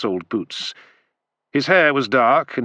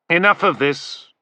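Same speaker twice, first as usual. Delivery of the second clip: sharp, raised snap; snapped; 7 semitones higher than usual. A narrator's reading. Cato stopping the bickering, sharp snap. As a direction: cut them off sharply. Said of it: "Cato snapped".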